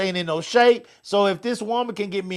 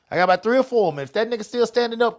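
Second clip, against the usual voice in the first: ordinary talk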